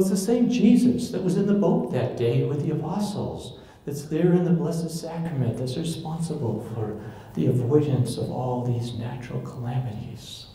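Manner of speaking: ordinary talk, nothing marked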